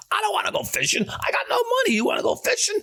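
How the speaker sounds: high shouted rant; yelling at Kenny about a fishing trip; retold yelling. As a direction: yell it at your friend in disbelief. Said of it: "I'm yelling at him".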